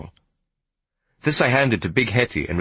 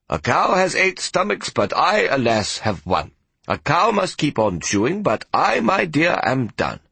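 lightly voiced old grandpa, playful and a bit higher; grandpa's saying; 7 semitones higher, slower than usual. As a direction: tease like a grandpa refusing seconds.

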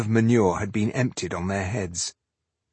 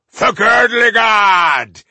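loud, high, drawn-out war cry; battle cry; 12 semitones higher, 10 dB louder, slower than usual. A narrator's reading. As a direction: cry it out as a rallying call to the army. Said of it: "came their cry".

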